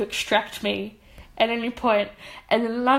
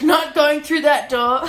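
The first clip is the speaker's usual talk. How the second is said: slow, louder, drawn-out refusal; mock dread; 5 semitones higher, 7 dB louder, slower than usual. Refusing to walk past the surveyor again, slow mock dread.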